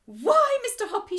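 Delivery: high, loud, surprised exclamation; delighted cry. Acted. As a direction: gasp it out in delight. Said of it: "she cried".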